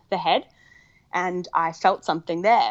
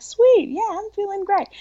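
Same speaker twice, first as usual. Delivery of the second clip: much higher, louder, beaming reply; giddy with relief and joy; elated; 13 semitones higher, 5 dB louder than usual. Retold or imitated. Telling the midwives she feels great, elated. Answering midwives, feeling wonderful.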